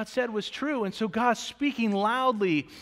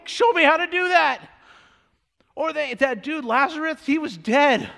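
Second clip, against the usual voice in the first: rising preaching; 6 semitones higher, 5 dB louder than usual. Real, not acted. Preaching builds, voicing disciples.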